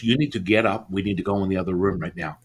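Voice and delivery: ordinary talk, nothing marked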